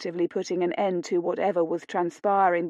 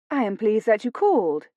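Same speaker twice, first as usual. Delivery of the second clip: ordinary delivery, nothing marked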